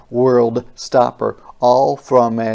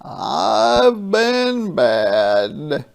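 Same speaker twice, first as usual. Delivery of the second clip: confessing; 9 semitones higher, 3 dB louder, much slower than usual. Acted. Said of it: "looked up and confessed".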